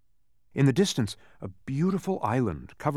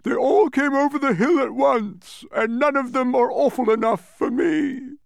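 a winded noisy brute, pitched way up and loud; puffing Din; 12 semitones higher, 6 dB louder than usual. A narrator's reading. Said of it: "he puffed".

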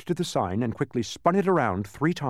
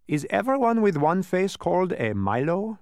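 tag; 11 semitones higher, 4 dB louder than usual. A narrator's reading. Dodecahedron questions Milo.

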